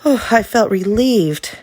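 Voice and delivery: ordinary delivery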